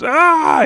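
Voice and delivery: a high, loud shriek of shock; yell. Retold or imitated. The king shrieking in panic at the news, high and loud. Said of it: "He yells".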